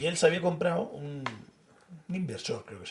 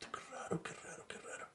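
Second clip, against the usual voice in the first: susurro imitado; 18 dB quieter than usual. Retold or imitated. Colega murmura al ver la web.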